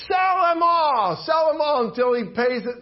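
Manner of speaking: loud harsh command, sell them all; king's shouted order. Acted. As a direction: bark the order like an angry king.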